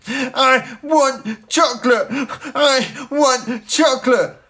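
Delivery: high, loud, slow tantrum shout; bawling. Acted. A red-faced girl bawling in a tantrum for chocolate. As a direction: demand it like a furious small child. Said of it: "bawled the girl".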